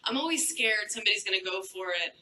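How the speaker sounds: ordinary delivery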